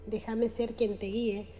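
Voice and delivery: guided meditation. Acted. Guide leading listeners through breathing and chakra visualization, calm meditation voice. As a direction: guide the listener gently into relaxation, unhurried.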